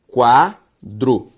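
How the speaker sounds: "quadro" broken into qua, dro; syllable by syllable. Shown as a demonstration. Teacher separating a word for students, "quadro" syllable by syllable. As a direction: sound out "quadro" in two drawn-out, separate syllables. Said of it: "Se eu separo quadro".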